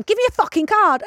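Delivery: impatient friend demanding the card, imitated; mock angry demand. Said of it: "So she was going".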